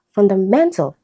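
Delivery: "fundamental" stressed on MEN; third-syllable stress. Shown as a demonstration. Teacher giving a root-word example, third-syllable stress. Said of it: "third syllable".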